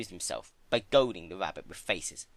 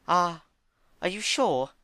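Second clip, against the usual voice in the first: suspicious Fluttershy; 9 semitones higher, 4 dB louder than usual. Acted. Fluttershy doubts Discord.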